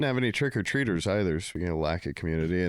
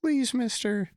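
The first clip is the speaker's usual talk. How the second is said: put-on high little kid pleading; child begging; slower than usual. Child at the door asking for candy, high pleading kid voice. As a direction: beg sweetly like a child. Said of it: "and was like".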